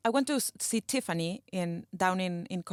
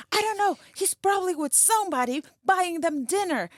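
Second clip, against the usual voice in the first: angry; 8 semitones higher than usual. Retold or imitated. Angry wife retold.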